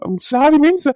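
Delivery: loud, high frantic protest; flustered denial. Acted. Embarrassed man frantically denies it to his boss, loud and high. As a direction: deny it in a panic.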